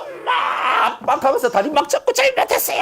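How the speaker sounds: put-on high, loud child begging for mercy; a child crying out to mom and dad; pleading child. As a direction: beg like a kid being spanked. Child begging during a beating.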